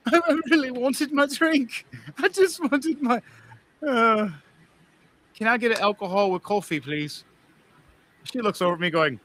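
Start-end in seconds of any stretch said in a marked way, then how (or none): 0.1-7.2 high, slow whining plea; whiny plea. Acted. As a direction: whine like a pouting child, much higher. Player whines about lost drink.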